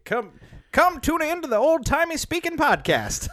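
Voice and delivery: put-on high old-timey radio announcer. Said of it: "People would be like".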